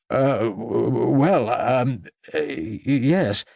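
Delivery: flustered stammer, slow to get out; startled. A narrator's reading. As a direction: stumble over each word, caught off guard. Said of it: "began a startled Anthony, unsettled".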